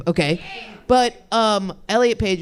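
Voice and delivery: ordinary talk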